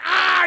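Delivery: high, loud "ah" of shock; mock scream. Man finding a dwarf hidden inside, mock scream.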